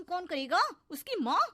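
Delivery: high, loud sarcasm; scornful jab. Acted. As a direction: spit out a taunting question and answer. Stepmother's sarcastic jab at girl.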